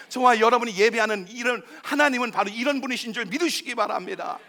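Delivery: loud, high, building sermon climax; full-force preaching. Acted. Pastor assuring sinners God carries them, full-force preaching. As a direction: drive the promise home to the crowd.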